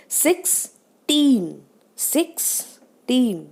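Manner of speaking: Sixteen slow, high, equal weight on six and टीन; both syllables stressed. Shown as a demonstration. Teacher showing pressure on both syllables, slow SIX-TEEN for sixteen. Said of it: "दोनों syllable पे stress आ गया".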